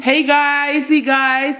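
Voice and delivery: high, bright-pitched hello; vlog intro. Acted. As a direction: greet the viewers like an intro. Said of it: "vlog intro greeting".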